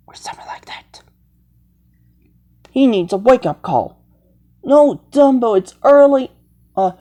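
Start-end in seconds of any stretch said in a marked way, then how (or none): 0.1-1.0 breathy whisper, no voice at all. Acted. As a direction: whisper it to yourself. Same phrase later said aloud.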